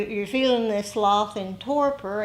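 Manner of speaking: ordinary talk, nothing marked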